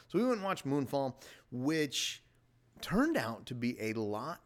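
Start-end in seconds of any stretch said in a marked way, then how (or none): none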